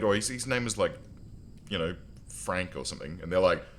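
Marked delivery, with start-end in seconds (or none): none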